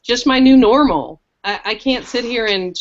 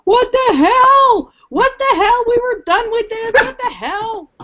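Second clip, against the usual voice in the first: high, loud mock scream of outrage; imitated screaming; 14 semitones higher, 5 dB louder than usual. Wife imitating husband at the oncologist, screaming outrage. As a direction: shriek it like an appalled husband. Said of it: "who started screaming at the oncologist".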